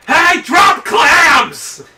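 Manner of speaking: high, drawn-out shout; super loud headset yell. Retold or imitated. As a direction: imitate a drive-thru worker bellowing into a headset. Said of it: "fucking super loud".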